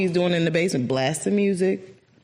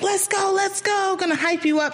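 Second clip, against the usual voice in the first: high hyped-up "let's go"; hype man; 14 semitones higher than usual. Retold or imitated. Mocking a rowdy motivator, high hype shout. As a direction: pump the crowd up. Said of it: "he's not one of those".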